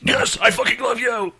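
high, hammed-up metal scream; metal guy. Metal guy professing love in the bit, mock metal scream. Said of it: "just talk like we're".

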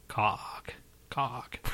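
"Cock" breathed in a sultry whisper. Pretend. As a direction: whisper "Cock" slowly and seductively. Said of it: "like, sultry like?".

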